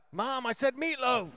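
put-on loud, high whiny man-child yell; whine-yell at mom from the basement; Will Ferrell impression. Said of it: "When Will Ferrell lives in the basement".

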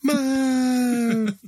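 put-on high wailing call of a sick kid; child calling out. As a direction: cry out for mom at night. Imitating child calling in the night.

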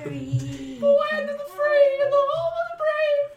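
very high squeaky singsong; proud anthem in a mouse squeak; tiny mouse boy. Acted. Mouse boy intones patriotic line.

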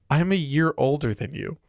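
usual speaking voice

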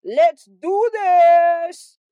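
hammed-up shout of let's go; rallying cry. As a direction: stretch it out and whip up the crowd.

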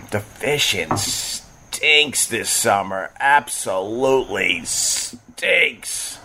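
slow, drawn-out grumble; disgusted emphasis. Real, not acted. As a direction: drag out every word in disgust. Complaining about a bad fishing summer.

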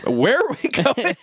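high-pitched incredulous question; hammed-up baffled demand; mock alarm. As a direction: imitate a startled passenger, voice way up. Prisoner alarmed at airport detour.

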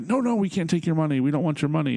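high, insistent quoted protest; protesting students. Retold or imitated. Acting out the students refusing.

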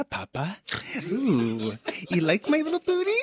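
put-on high, breathy little boy dirty talk. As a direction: coo it like a small boy. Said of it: "that little boy voice should never be done again".

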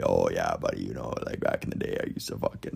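low, half-mumbled Canadian impression. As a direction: sink into a low Canadian buddy voice. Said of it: "Even, like, deeper than that".

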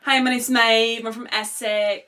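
put-on slow Love Island Essex accent. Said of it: "can I do the Love Island one".

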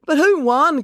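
high, loud call from the crowd. A narrator's reading. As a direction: shout the question over the crowd. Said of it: "called Animesh from the crowd".

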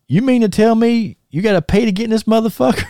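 high, disbelieving retold blurt; mock-outraged "you mean to tell me"; incredulous outburst. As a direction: act shocked that you have to pay. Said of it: "Like that".

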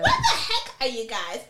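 half laughing the question out. Retold or imitated. Retold delighted call to friends.

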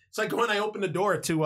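ordinary talk

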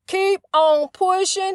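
slow, deliberate Keep on pushing; easing the pace on purpose. Shown as a demonstration. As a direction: stretch it out, unhurried. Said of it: "Let me slow down".

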